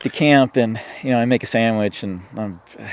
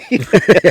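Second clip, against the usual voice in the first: loud, high burst of laughter; real burst of laughter; 10 semitones higher, 9 dB louder than usual. Real, not acted. Laughing about the miserable earlier hunting trip. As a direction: laugh out at the memory.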